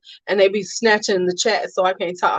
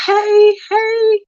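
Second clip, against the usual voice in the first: pitched way up and loud; high squealed hey; 12 semitones higher, 5 dB louder than usual. Real, not acted. Streamer greeting arriving viewers, squealed.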